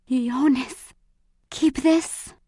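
high, frail voice; feeble, tender request; weak, dying mother. A narrator's reading. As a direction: speak with effort from a sickbed. Dying mother speaks to her son.

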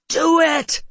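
high, loud scream; full-force shouted demand, pitched high; screamed challenge. A narrator's reading. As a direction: scream it at her as a dare. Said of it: "he screamed at her".

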